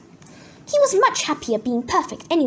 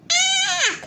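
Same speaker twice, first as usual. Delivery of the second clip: very high shrieked "ah"; high cry without full volume. A narrator's reading. Peter shrieks in his room, very high cry. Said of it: "shrieked Peter".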